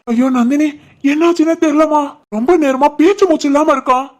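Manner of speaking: high, fretful fake distress; pretend panic. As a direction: break bad news in put-on alarm. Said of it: "எழுப்புற மாதிரி பதட்டமா இருடா". (பதறுவது போல நடித்தல்)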